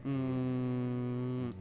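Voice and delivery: usual speaking voice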